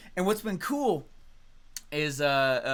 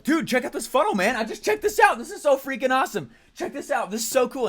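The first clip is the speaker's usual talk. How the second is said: much higher, louder gushing; excited show-off; 9 semitones higher, 6 dB louder than usual. Retold or imitated. Showing Russell a new funnel, high and thrilled. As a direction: gush like a kid with a new toy. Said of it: "Next day, like".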